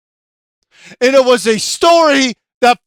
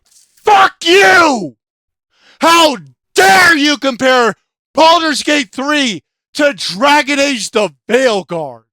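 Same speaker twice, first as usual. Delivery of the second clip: furious shout. Real, not acted. Yelling at a commenter for comparing the games, furious. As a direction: blast him with outrage.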